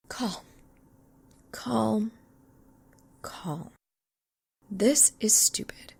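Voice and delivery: whispering to calm herself down; whispered self-calming. Acted. Calming herself inner thought.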